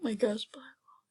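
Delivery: hushed whisper, savouring the name; awed whisper. Real, not acted. Gamer whispering the rare pet's name in awe. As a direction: whisper it like a secret treasure.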